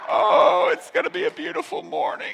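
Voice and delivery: high, hammed-up voice of an awed worshipper; gushing churchgoer. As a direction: imitate delighted churchgoers in the back. Mimics people in the back rejoicing.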